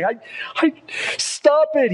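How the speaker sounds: put-on a boy begging the voices in his head to stop; boy pleading with voices. Preacher voicing a tormented boy: high pleading cry. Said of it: "you hear his voice say".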